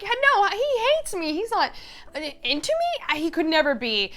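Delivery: high, protesting disbelief; acted indignant incredulity; flustered denial. Acting Odette's disbelieving denial.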